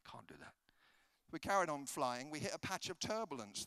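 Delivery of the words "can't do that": low, very soft aside fading to a whisper; hushed refusal. Acted. Traveller privately refusing his evangelist friend, hushed refusal. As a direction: refuse under your breath. Refusing beside the nervous passenger.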